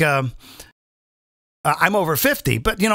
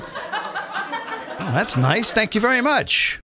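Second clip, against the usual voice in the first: sarcastic thanks; 3 semitones higher, much slower than usual. Retold or imitated.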